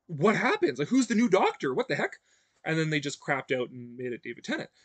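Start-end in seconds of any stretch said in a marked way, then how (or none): 0.1-2.2 louder, higher, worked-up questions; excited. Real, not acted. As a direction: build the thrill of the cliffhanger. Excited cliffhanger rant.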